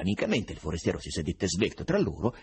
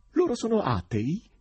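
curious little cry; 14 semitones higher, 4 dB louder than usual. A narrator's reading. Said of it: "un gridolino di curiosità".

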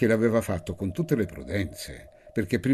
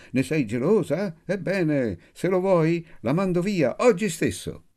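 voce acuta, molto sopra il tono del narratore; grido narrato; 11 semitones higher than usual. A narrator's reading. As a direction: grida la sfida con intonazione alta, senza forzare troppo il volume. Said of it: "egli gridò". (battuta gridata di personaggio, recitata in registro acuto)

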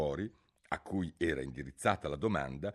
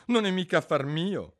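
high, loud, cheeky comeback; bold retort; 10 semitones higher, 6 dB louder than usual. A narrator's reading. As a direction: talk back to the queen, pitched up. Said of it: "sorpresa del suo stesso coraggio". (risposta coraggiosa)

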